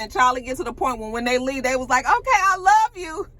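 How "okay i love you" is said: high-pitched, loud mock affection; mock gushing. Group saying goodbye after a blow-up, mock gushing. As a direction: gush it in an exaggerated high voice.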